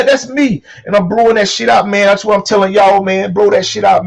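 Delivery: usual speaking voice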